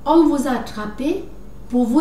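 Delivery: usual speaking voice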